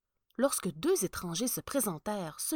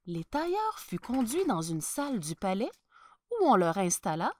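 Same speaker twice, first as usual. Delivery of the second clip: ordinary delivery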